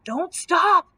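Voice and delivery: high, loud urgent growl. A narrator's reading. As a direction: bark the order mid-song. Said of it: "growled the Cowardly Lion".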